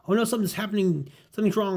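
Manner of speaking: high, loud comic-book alarm; acted alarm. Spider-Man as his spider sense grows unbearable, alarmed. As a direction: sound the alarm as a hero in trouble.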